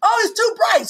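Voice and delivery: high, exclaimed complaint; mocking complaint. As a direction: quote her fussy complaint. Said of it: "So you told me".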